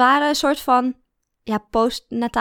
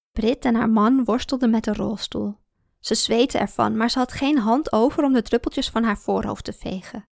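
usual speaking voice

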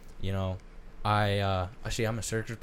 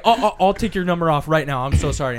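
panicked caller; 6 semitones higher, 6 dB louder than usual. Retold or imitated. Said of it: "he's like".

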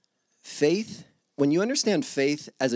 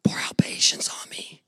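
breathy mock whisper of a plea; hushed pretend prayer for patience. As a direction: whisper it like a timid petitioner. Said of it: "You're like".

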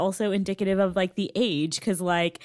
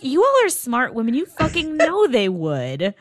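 exasperated retort; 6 semitones higher, 6 dB louder than usual. Retold or imitated. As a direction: scold the characters, exasperated. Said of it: "And I'm like".

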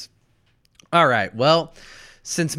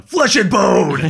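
hammed-up shout, louder and higher; mock battle cry; 7 semitones higher, 8 dB louder, slower than usual. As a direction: belt it out like a silly war cry. Repeats own phrase as a shout.

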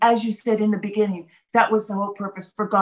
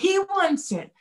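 emphatic; 9 semitones higher than usual. Acted. Repeating for emphasis.

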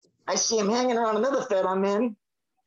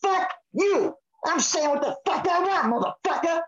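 defiant outburst; 6 semitones higher than usual. Real, not acted. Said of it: "[name]'s on a roll".

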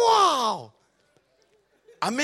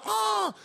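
stalling ah; 12 semitones higher than usual. Retold or imitated. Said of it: "I'm like".

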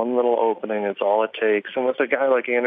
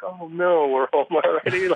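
higher-pitched, playful "oh no" lament; mock dismay; 6 semitones higher than usual. Passenger not wanting the flight to land, mock dismay. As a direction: act out mild dismay, pitched up.

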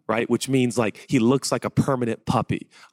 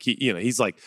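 ordinary talk, nothing marked

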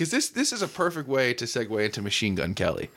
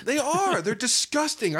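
disgusted by taste; 10 semitones higher than usual. Real, not acted. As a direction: recoil in mock horror. Kids lick each other.

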